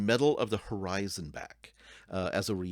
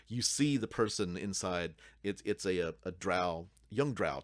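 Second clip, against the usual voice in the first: normal talking voice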